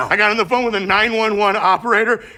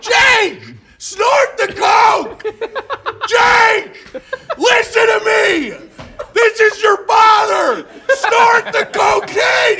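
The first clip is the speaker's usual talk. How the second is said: high, loud, slow urgent yells; shouting encouragement; 12 semitones higher, 5 dB louder, much slower than usual. Real, not acted. Urging his friend Jake on, slow loud shouting. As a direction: shout him on like a frantic coach.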